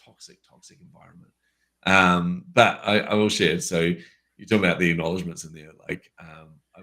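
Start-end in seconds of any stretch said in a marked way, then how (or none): 0.1-1.3 breathy hushed emphasis; whispered, still disgusted. Real, not acted. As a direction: drop to a whisper on toxic. Describing workplace.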